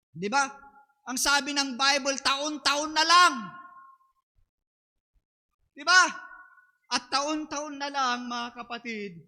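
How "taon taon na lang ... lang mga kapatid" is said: emphatic preaching. Acted.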